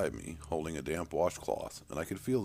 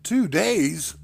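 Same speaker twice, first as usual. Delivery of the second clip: higher, louder startled cry of disbelief; shocked exclamation; 13 semitones higher, 12 dB louder than usual. Acted. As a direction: blurt it out in shock. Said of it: "I exclaimed".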